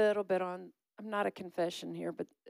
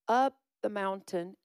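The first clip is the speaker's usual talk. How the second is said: ordinary talk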